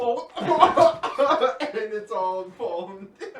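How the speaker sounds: loud laugh breaking through words; laughing loudly. Real, not acted. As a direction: crack up while you say it. Light crashes, everyone laughs.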